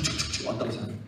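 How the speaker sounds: whispered stomping sound of soldiers; marching sound effect. Pretend. Preacher miming soldiers marching in, whispered. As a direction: breathe out each 쭉 like marching feet. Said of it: "목사님은 이렇게 하는 게 웃기잖아요?".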